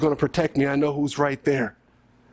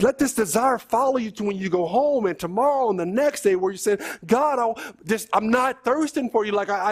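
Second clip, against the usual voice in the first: impassioned, higher-pitched exhortation; fervent preaching; 8 semitones higher than usual. Real, not acted. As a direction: press the congregation earnestly, voice lifted high. Preacher urging the congregation.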